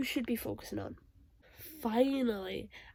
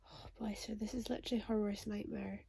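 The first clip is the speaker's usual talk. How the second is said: whisper; 3 semitones lower, 9 dB quieter than usual. Real, not acted. Pity for the character.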